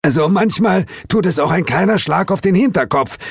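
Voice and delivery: high, strained croak; croaky character voice. A narrator's reading. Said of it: "krächzte Suko".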